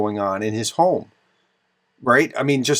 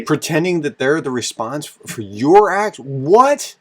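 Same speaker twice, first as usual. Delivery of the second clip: rising higher and louder in disbelief; incredulous repeat; 3 semitones higher, 4 dB louder than usual. Real, not acted. Vlogger echoing the envoy's claim, incredulous and louder. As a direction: echo his words, then a stunned what.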